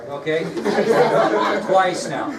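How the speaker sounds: ordinary talk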